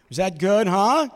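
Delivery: loud, pleased aside to the audience; milk the crowd for praise; proud, fishing for approval. Real, not acted. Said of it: "asking audience for approval".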